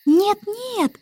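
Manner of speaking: put-on high, childlike impatient protest; impatient interruption. A narrator's reading. Baby interrupts the starling's guess, high and impatient. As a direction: cut in as a fretful tiny girl. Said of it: "нетерпеливо перебила Аннабелла".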